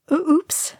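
small, high-pitched oops; sheepish. A narrator's reading. Pippin after the thunderous crash, sheepish. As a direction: let it slip out high and guilty. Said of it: "his face sheepish".

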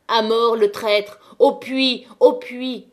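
loud, slow cry for death; mob shout. Acted. The crowd howls at the traitor, furious shout. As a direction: bellow it like an angry mob.

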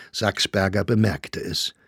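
plain reading voice

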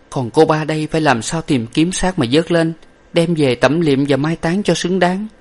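high-pitched plaintive question; sorrowful young woman. A narrator's reading. Young lady asking how to recover the body, sorrowful.

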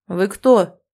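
a guard barking at a stranger; rounding on her. Acted. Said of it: "напустился на меня мужчина".

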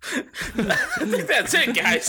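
slow, high, through laughter; laughing. Real, not acted. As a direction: speak while cracking up. Laughing while talking.

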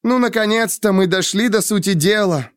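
high-pitched exasperated cry; angry exclamation. A narrator's reading. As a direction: exclaim with sarcastic relief. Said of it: "воскликнула Денна". (раздражённо восклицая)

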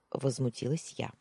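ordinary delivery

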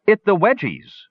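high, excited little shriek; gully dwarf shrieking with excitement. A narrator's reading. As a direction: shriek it out, thrilled at the sight. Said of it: "Numskull shrieked suddenly".

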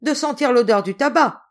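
loud, high, jovial interruption; loud cry. A narrator's reading. General teasing his niece as he cuts in, loud cry. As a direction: burst in loudly to finish the sentence. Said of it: "cria le général".

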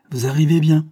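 loud, high cry of greeting; exclamation. A narrator's reading. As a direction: cry out to your ally. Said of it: "s'écria son allié".

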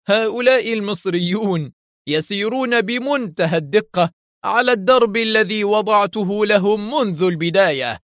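very high, theatrical villain boast; Yuri, savage gloating. A narrator's reading. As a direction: gloat cruelly, rising and stretching toward the end. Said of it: "ليضيف في زهو وحشي".

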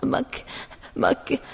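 put-on halting, alarmed child's voice; anxious muttering. A narrator's reading. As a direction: stutter into a worried, high question. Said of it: "تمتمت جود بقلق".

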